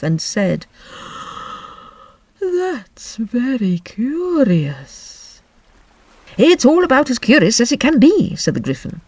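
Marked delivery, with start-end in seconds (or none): 2.4-5.4 slow, mournful turtle voice; Mock Turtle voice. Mock Turtle after a long breath. 6.4-8.3 loud, brisk creature voice; Griffin voice. Acted.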